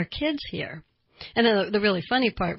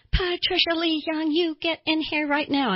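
mother yelling; 9 semitones higher than usual. Retold or imitated. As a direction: summon the kid like an angry Southern mama. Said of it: "she yells out".

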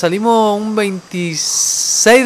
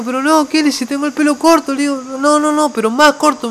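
higher-pitched, exasperated pleading; retold protest; 8 semitones higher than usual. Employee protesting to the administrator about a haircut, high pleading objection. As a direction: re-enact arguing back, pitched well up.

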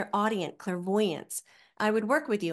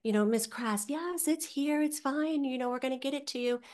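sweet customer rep; 7 semitones higher than usual. Retold or imitated. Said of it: "And they're like".

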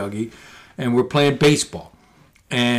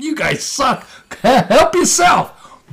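high, drawn-out scornful taunt; mock jeering laughter; 12 semitones higher, 5 dB louder, much slower than usual. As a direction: imitate rivals laughing off a hopeless bet. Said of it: "and they laugh".

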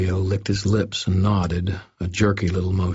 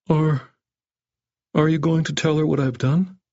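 meek, fearful question; 9 semitones higher than usual. A narrator's reading. Cowed doctor fears boy's mother.

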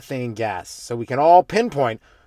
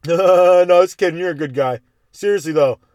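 a hint of the drunk, louder then settling; drunk guy backpedaling; 4 semitones higher, 5 dB louder than usual. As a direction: drunk man taking it back. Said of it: "some drunk guy".

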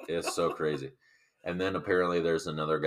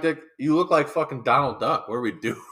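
laughing retold quip; 10 semitones higher, 6 dB louder than usual. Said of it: "I'm like".

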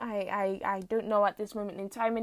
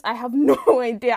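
usual speaking voice

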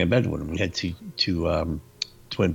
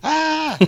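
much higher, louder mock scream of fright; retold scream; 6 dB louder than usual. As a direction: relive the kid's terrified shriek. Retelling his fright scream.

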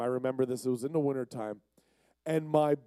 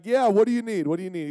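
eager; 5 semitones higher, 6 dB louder, faster than usual. Retold or imitated. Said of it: "And I'm like".

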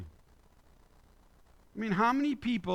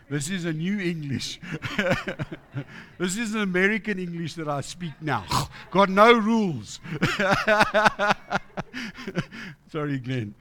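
laughing; 5 dB louder than usual. Real, not acted. Laughs at his own grammar slip.

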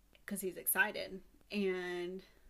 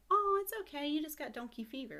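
mouse voice, consoling; 6 semitones higher, 5 dB louder than usual. Retold or imitated.

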